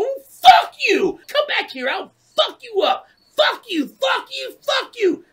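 high, loud shouted tirade; re-enacted screaming fury, repeated volleys; mad. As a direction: keep yelling abuse after the car. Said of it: "I was so mad, dude".

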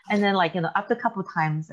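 ordinary talk, nothing marked

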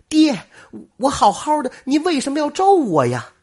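high, loud cry of protest; shrill cry. A narrator's reading. Daughter shocked by her own grave marker, crying out high and loud. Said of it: "尖声叫了起来".

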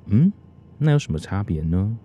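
ordinary talk, nothing marked

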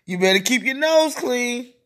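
normal talking voice

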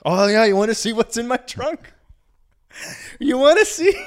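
high, loud, drawn-up challenge; mock defiant taunt. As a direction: dare the police, hammed up as the drunk friend. Friend taunts police about trunk.

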